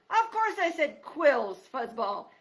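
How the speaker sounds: loud, slow, scornful retort; old porcupine mocking the mouse; loud porcupine scoff.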